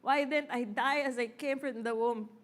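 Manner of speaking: normal talking voice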